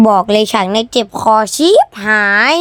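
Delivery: loud shout, voice strained. Real, not acted. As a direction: yell it out till the throat hurts. (ตะโกนเสียงดังจนเจ็บคอ)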